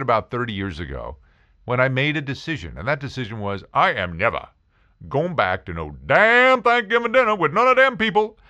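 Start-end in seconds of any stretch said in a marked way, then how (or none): 3.7-8.4 defiant refusal, jumping high and loud; hammed-up indignant rant. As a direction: rant in a put-on voice about shunning relatives. Acting out defiant family rant.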